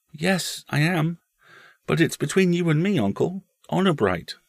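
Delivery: put-on high-pitched, sincere child voice; solemn small boy. Boy swearing his uncle to secrecy, solemn. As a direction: confide it with a child's earnestness.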